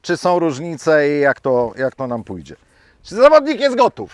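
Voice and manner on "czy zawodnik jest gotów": high, loud official call; range officer call. Acted.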